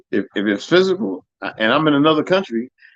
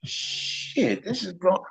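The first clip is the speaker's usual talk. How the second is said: hushed alarm; 4 semitones higher, 9 dB quieter, much slower than usual. Retold or imitated. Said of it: "I was like".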